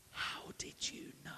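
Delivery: hushed, amazed whisper; whispered mock astonishment; stunned whisper. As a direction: breathe it out in awe.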